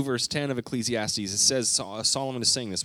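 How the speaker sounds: ordinary delivery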